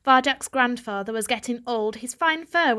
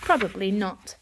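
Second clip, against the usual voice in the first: ordinary delivery, nothing marked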